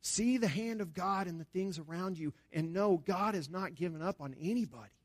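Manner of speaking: ordinary talk, nothing marked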